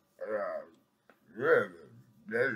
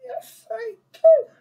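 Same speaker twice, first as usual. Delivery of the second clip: slow, shrieking much higher and louder; high wailing cry; 16 dB louder, slower than usual. Pretend. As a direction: wail it out like a diva.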